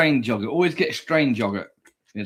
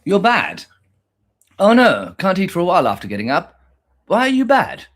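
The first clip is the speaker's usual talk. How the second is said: high, louder playful telling-off of the pet; climbing higher with each mock scold; 5 semitones higher, 6 dB louder than usual. Real, not acted. Scolding buddy the pet for being bad, high and playful.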